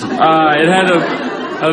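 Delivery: usual speaking voice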